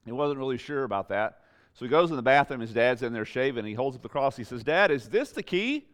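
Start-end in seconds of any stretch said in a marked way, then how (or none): 4.6-5.9 put-on high, slow child's voice; little boy. Young son asking his shaving dad, high and childlike. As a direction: ask it like a small boy, wide-eyed.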